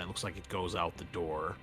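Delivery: ordinary delivery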